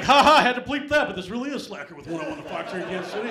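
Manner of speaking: booming radio host delivery; excited DJ. Retold or imitated. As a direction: pump it up like a morning DJ. Radio DJ calling back, excited.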